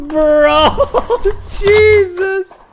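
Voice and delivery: high, loud laughing bro; laughing hard. Real, not acted. Streamer reacts to the clip, laughing loudly. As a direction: crack up at the clip. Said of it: "laughing hard at the clip".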